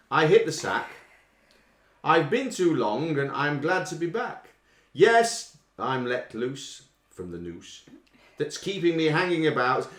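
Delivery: slow, declaimed lyric reading; quiz lyric reading. Acted. Quizmaster reading song lyrics slowly to the listeners.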